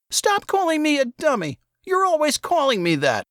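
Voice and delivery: put-on high, wounded kid voice; boy Saul, hurt. A narrator's reading. Saul complains to his brother, high and hurt. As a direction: protest like a boy near tears.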